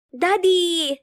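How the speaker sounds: put-on high boyish call to daddy; child calling out. A narrator's reading. Boy running to his father, high excited call. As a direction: cry out to your father. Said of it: "sigaw ni Asher".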